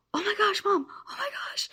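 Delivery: high, loud gasping excitement; excited child. Retold or imitated. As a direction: imitate a son bursting with excitement. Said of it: "he's getting super excited".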